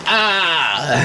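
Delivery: sudden high yelp, acted out; mock pained yelp. Retelling lying in the road, a high mock yelp. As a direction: yelp like you just got hit.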